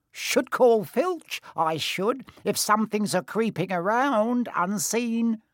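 high, drawn-out imp voice; threatening poltergeist. Acted. Peeves threatens to report unseen intruders, high imp voice. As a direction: threaten to tell, in a high teasing voice.

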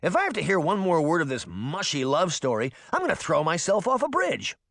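put-on high, exasperated boy's rant; begging. A narrator's reading. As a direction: complain like a kid sick of mushy romance. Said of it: "Puck begged".

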